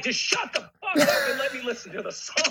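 high, fed-up snap; exasperated listener. Retold or imitated. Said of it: "they're like".

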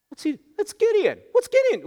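high, far-off puzzled townsperson voice; a villager squinting and asking in a high voice; townspeople voice. Retold or imitated. A townsman voiced by the preacher, in a high puzzled voice.

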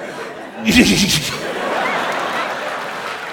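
breathy imitated giggle of the friend. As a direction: giggle at nothing. Friend's giggle acted out again.

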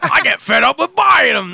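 lightly voiced cartoon caveman; Fred Flintstone voice. Asked to read the quote as Fred Flintstone, cartoon voice. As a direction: do a quick Flintstone impression. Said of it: "in your Fred Flintstone accent".

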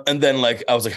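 ordinary delivery

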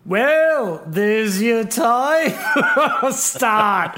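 high, drawn-out attempt at Liverpool accent. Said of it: "me putting on a Beatles accent".

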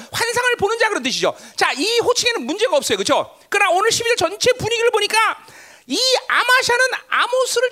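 high, loud pulpit delivery; forceful preaching. Real, not acted. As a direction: preach it with urgent, punched questions.

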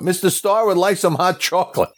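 high calling voice to the crew. Retold or imitated. Director calls to the crew for hot chocolate, high call. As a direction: announce the request to the crew.

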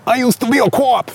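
high put-on New York voice; hammed-up New Yorker line; New York impression. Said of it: "That's mine".

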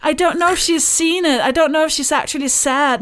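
hammed-up anxious troll, laughing through it; mocking troll voice. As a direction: squeak it out as a silly worried troll. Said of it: "someone would be like".

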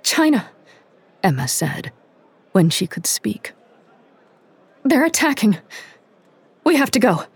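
high, urgent alarm; frightened rush to warn; panicked. A narrator's reading. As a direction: blurt it out, shaken. Emma panicked with bad news.

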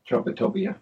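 ordinary talk, nothing marked

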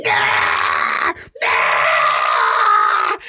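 long, drawn-out mock scream of an angry mom; imitated screaming. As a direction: screech wordlessly, imitating a furious mother. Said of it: "when mom started screaming".

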